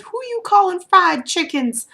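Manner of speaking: high, loud offended snap; mock outraged comeback; indignant retort. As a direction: heckler firing back, mock indignant.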